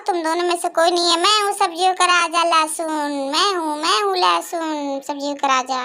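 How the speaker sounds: normal talking voice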